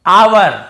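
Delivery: "आवर" loud, opening on a long aa; silent h. Shown as a demonstration. Teacher introduces the word for time, h silent. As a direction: say आवर firmly with no h. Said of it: "एच silent होगा".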